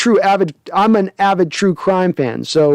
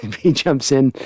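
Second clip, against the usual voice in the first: usual speaking voice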